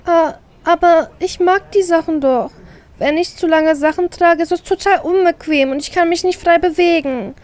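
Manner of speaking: high, pleading little-angel voice; sweet begging to win him over; puppy-eyed pleading. Acted. Little sister pleads with her brother with puppy eyes. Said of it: "mit meinem besten Welpenblick".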